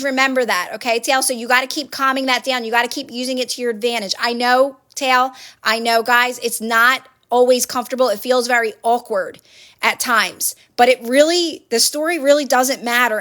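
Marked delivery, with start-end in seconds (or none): none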